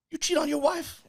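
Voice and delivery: high, teasing question; teasing comic's question. Retold or imitated. Older comic teasing [name] about his wife, high and sly. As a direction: lightly mimic the older comic probing. Patrice quizzing him at weekend start.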